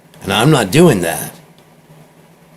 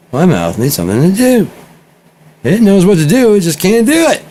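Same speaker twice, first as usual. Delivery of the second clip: high, louder put-on baby voice; frustrated baby voice; 12 semitones higher, 6 dB louder than usual. Baby explaining why it wants a pacifier, high put-on baby voice. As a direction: voice the restless baby's mouth. Baby's inner voice.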